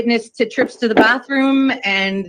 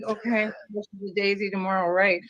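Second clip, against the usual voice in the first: quick, much softer, doubtful repeat of fresh as a daisy tomorrow; sarcastic echo; 7 dB quieter, faster than usual. Retold or imitated. Repeating the drunk man's text back, softly sarcastic. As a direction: throw his promise back, unconvinced and under the breath. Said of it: "And I'm like".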